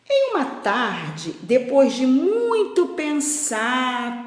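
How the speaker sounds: slow, emphatic storyteller, stretching key words; picture-book reading with long pauses; children's story narration. A narrator's reading. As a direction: read it aloud to young pupils, lingering on each phrase. Reads story to pupils.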